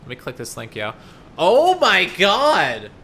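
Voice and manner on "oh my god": loud, slow amazed gasp of delight; amazed shout. Real, not acted. Streamer reacting to a funny emote, amazed. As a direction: blurt out pure amazement.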